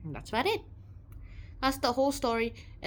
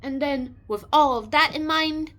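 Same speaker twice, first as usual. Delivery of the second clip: ordinary talk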